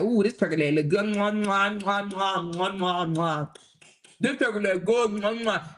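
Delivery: smack your lips over a turkey leg; hungry biter. Pretend. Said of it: "They might try to do that, like".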